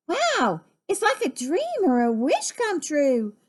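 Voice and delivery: high, marveling exclamation; awed wonder. Acted. Gertie seeing the rainbow after the storm, full of wonder.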